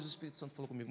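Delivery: usual speaking voice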